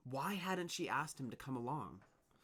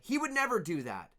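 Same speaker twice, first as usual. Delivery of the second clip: defending; 11 semitones higher, 10 dB louder than usual. Acted. Worker defends Dune.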